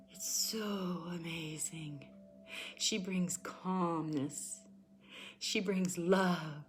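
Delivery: calm hushed tarot voice; tender soft reading. Real, not acted. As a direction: soothe them gently. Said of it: "tender card reading".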